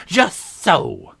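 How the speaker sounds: Red Queen exclaiming in eager agreement; cried out. A narrator's reading. Red Queen crying out to Alice, loudly pleased. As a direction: pounce on it with delight. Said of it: "cried the Red Queen".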